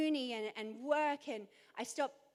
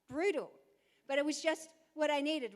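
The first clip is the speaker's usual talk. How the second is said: ordinary delivery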